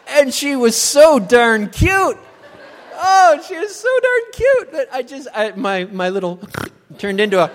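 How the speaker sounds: can't stop laughing; laughing while talking. Real, not acted. Laughing while telling the story.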